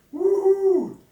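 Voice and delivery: a loud, high whoop of delight; excited whoop. Real, not acted. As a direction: let out a big cheer. Excited cheer.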